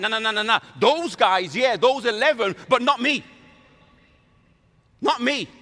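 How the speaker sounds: loud, high-pitched protest; hammed-up indignant objection; insistent denial. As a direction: push the blame away from yourself. Peter denies he is included.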